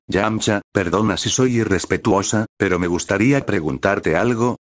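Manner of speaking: usual speaking voice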